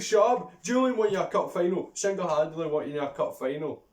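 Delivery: loud, high outburst easing slightly; exasperated telling-off. Real, not acted. Ranting at fickle fans about Julian, loud exasperated rebuke. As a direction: cut them down in exasperation.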